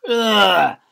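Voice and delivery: high, loud disgusted ugh; school groan. Acted. Bored pupils groaning at the family, loud ugh. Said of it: "the whole school groaned".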